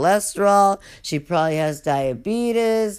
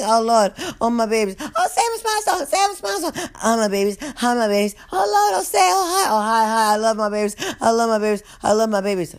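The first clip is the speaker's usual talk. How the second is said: perked-up guard; 6 semitones higher, faster than usual. Retold or imitated. Guard arriving full of energy, hammed-up cheer. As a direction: bounce in like she just got energy. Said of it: "she would have had energy".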